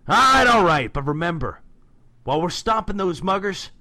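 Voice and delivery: loud, high, grudging agreement; acted exasperated shout. Raph giving in to Casey, loud exasperated acted shout. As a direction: burst out as if giving in.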